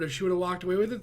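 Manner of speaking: ordinary delivery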